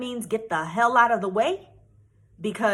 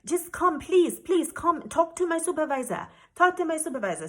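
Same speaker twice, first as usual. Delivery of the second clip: pleading officer; 6 semitones higher than usual. Retold or imitated. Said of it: "He was like".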